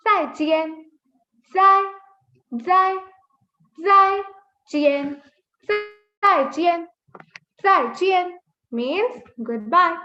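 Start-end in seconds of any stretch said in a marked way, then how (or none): 0.1-8.4 "Zai" louder and higher, then falling; falling fourth tone. Shown as a demonstration. Teacher drilling goodbye, "Zai chien" with falling pitch. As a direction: punch "zai" and let the pitch fall. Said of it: "so your pitch just falls".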